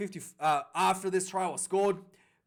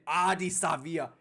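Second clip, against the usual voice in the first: ordinary talk, nothing marked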